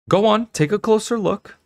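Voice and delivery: high, eager urging; beaming. A narrator's reading. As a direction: urge her closer, beaming with pride.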